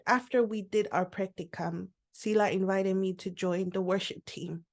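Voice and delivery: ordinary delivery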